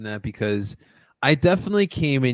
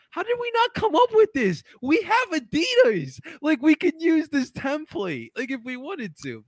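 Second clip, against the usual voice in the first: mock envious. Voicing Colombia's envious reaction.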